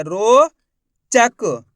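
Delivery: "रोचक" stretched very slowly with a gap; "रोचक" broken in two, loud and slow; split into syllables. Shown as a demonstration. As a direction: draw out a long rising ro, pause, then chak for "रोचक". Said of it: "ये चा लिख दिया".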